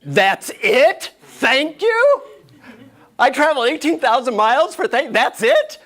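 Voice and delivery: high, loud incredulous questions; hammed-up outrage at being let down; indignant disbelief. Acted disbelief at the guru's mantra.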